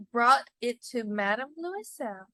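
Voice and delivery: plain reading voice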